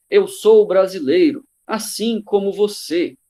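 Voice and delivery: slow, emphatic mock candidate pitch; campaign ad imitation. Teacher quotes a candidate's TV ad, slow mock campaign delivery. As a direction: imitate a politician's TV ad, stretching the words. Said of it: "falando bem assim".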